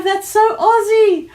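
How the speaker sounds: high, loud and drawn out; thrilled, stretched-out shout; excited exclamation. Real, not acted. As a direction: proclaim the new catchphrase with glee. Excited at a new catchphrase.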